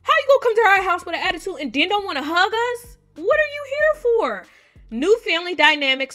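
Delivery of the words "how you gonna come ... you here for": mock indignant scolding. Scolding the rude visitor.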